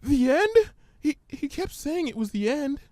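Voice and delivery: high, hesitant frightened pony; Fluttershy's voice. Acted. Fluttershy fearfully reciting the ominous words, timid and high.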